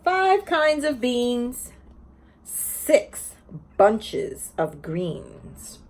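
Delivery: slow, lilting picture-book read-aloud; sing-song story reading to a class; reading to children. Acted. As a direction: read each page like story time for little ones.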